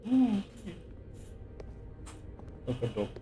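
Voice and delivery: usual speaking voice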